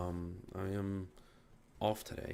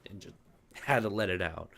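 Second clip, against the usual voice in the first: usual speaking voice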